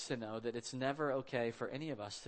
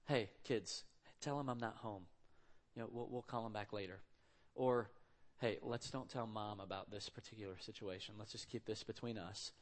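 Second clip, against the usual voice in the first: hushed secret; 3 semitones lower, 8 dB quieter than usual. Retold or imitated. Parent coaching kids to lie.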